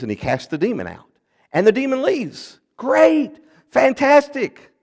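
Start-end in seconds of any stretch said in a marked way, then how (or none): 1.5-4.7 very high-pitched, drawn-out cheer; mock celebration. Preacher cheers the demon leaving before the catch, mock celebration. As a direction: celebrate it loudly, as if all is well.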